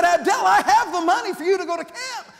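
high, loud, eager announcement; bursting to tell the boy something; lit-up bus captain; excited news. Retold or imitated.

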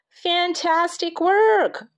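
high, slow gushing praise; cried out. Acted. Teacher Mister Hoppy Pot crying out praise. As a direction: gush over the poster. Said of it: "cried Mister Hoppy Pot".

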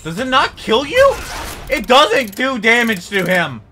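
scared horror game streamer. Real, not acted. Fighting unkillable enemy.

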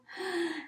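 high storyteller's gasp; sudden mock-shocked intake of breath; alarmed gasp. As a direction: gasp as if danger looms. Storyteller gasps before the fall.

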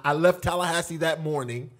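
normal talking voice

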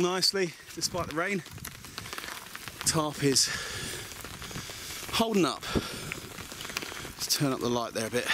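breathless after chopping wood; panting, out of breath. Real, not acted. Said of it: "So I'm out of breath, I've just been cutting wood".

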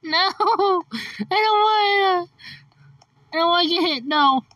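ordinary talk

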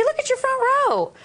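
high, delighted squeal of praise; excited high-pitched cooing; gushing admiration. Real, not acted. Guest gushes over the front row.

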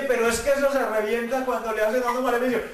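protesting excuse. Retold or imitated. Excusa apresurada ante Dios. (excusa apresurada y aguda)

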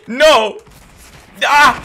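shouted dismay; loud cry. Real, not acted. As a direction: yell out as you lose. Dying in game. (luide kreet)

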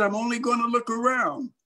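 high, lightly voiced kid; a hint of a defensive youngster; protesting boy. Boy answers the hollering kids.

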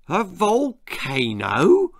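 father taken aback by the word volcano; startled father asks, drawn out. Acted. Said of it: "said his startled father".

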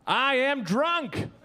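ordinary talk

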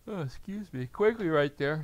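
talking through a yawn. Real, not acted. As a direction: say it mid-yawn. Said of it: "I'm so sorry I'm yawning".

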